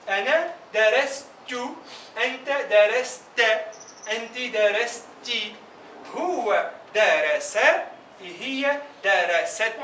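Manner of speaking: teacher sounds out each form slowly; slow model pronunciation. Shown as a demonstration. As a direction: spell out every syllable for the class. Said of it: "Repito la pronunciación".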